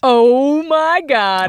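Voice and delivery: slow, drawn-out excited exclamation; excited gasp. Real, not acted. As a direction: stretch it out in delight. Excited reaction to birthday trip.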